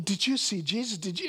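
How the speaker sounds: high, eager questioning; excited disbelief. Retold or imitated. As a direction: gush at a friend about what you just saw. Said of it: "It's like".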